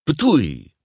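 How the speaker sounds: loud, high spoken spitting noise; mock-spit the nonsense word; pretend spit. Guido at the reporters behind glass, pretend spit. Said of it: "He pretended to spit at them".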